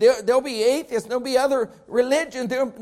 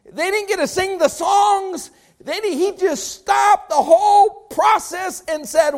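full-force preaching; 9 semitones higher, 6 dB louder than usual. Acted. Preacher contrasting facts and truth, full force. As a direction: drive each phrase home to the church.

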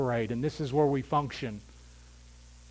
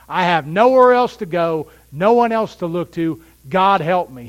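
louder cry of a person at rock bottom; desperate plea; 3 semitones higher, 9 dB louder than usual. Pretend. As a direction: plead as if out of options. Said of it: "hitting rock bottom and saying".

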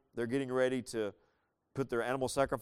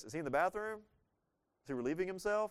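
higher-pitched teasing questions; mocking taunt; 6 semitones higher, faster than usual. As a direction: needle them with mock-innocent questions. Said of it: "Elijah starts mocking them".

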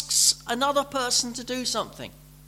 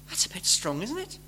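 ordinary talk

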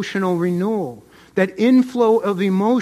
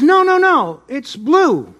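insistent contradiction; 6 semitones higher, 4 dB louder, slower than usual. Acted. Donkey argues with the tiger.

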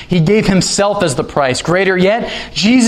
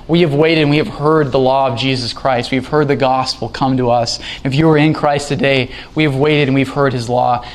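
ordinary delivery

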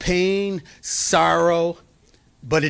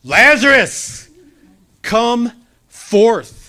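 Jesus calling Lazarus out of the tomb, loud; loud command; 7 semitones higher, 7 dB louder, much slower than usual. Acted. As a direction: call out loud and high, slowing on Lazarus. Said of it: "he says in a loud voice".

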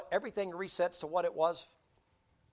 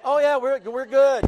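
mock-casual brush-off, louder and higher; imitating townsfolk shrugging it off; smug townsfolk; 8 semitones higher, 11 dB louder, slower than usual. Preacher mocks the people brushing it off, high and loud. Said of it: "They were like".

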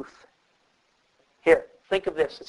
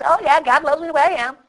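mock careless shrug; 9 semitones higher, faster than usual. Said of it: "and you just".

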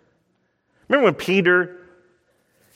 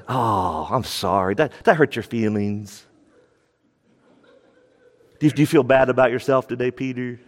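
sarcastic mock pity; 6 semitones lower than usual. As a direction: needle him with fake sympathy. Jesus mockingly rebuffs Peter.

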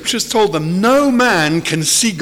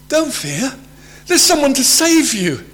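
urgent reassurance; 8 semitones higher, 3 dB louder than usual. Acted. Acting out the angel's reassurance.

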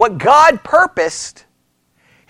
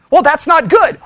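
ordinary talk

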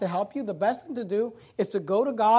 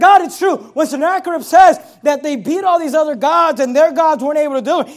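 raised, loud, rolling pulpit delivery; forceful preaching; 7 semitones higher, 10 dB louder than usual. Acted. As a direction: preach the admission out to God. Preaches Hezekiah's admission with force.

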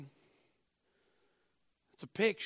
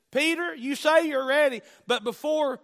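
louder, higher preacher's quote; forceful warning; 8 semitones higher, 9 dB louder than usual. Retold or imitated. Jesus warning Peter hours before the denial, forceful warning. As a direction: deliver a loud, emphatic warning.

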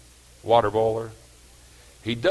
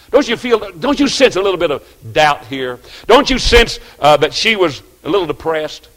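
loud, higher, climbing preacher cry; full-force pulpit delivery; preaching at full force; 5 semitones higher, 10 dB louder than usual. Acted. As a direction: preach the discouragement out to the pews.

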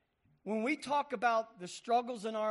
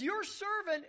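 high, urgent call to the king; plead, insistent and raised; a boy pressing his case before the king; arguing; 11 semitones higher, 3 dB louder than usual. Acted. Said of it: "and he's arguing".

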